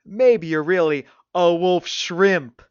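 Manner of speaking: slow, loud build to a mocking punchline; teasing suggestion. A narrator's reading. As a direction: draw it out toward "wolf shrimp". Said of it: "suggested with a wink".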